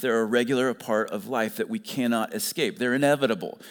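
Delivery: normal talking voice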